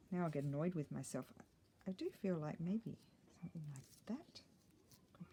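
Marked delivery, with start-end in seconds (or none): 3.4-5.3 whispered aside to herself. Real, not acted. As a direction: breathe it, barely voiced. Musing to herself while crafting.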